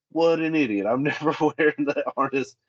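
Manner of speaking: high, scoffing imitation of a dismissive worker, with a laugh; mock scoffing worker. Safety guy imitating the worker he lectured, high and scoffing. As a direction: sneer it off like a know-it-all, chuckling.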